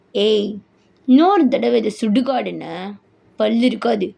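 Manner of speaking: threatening husband. Acted. Husband forbidding wife to say graveyard, threatening. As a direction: threaten her low and deliberately.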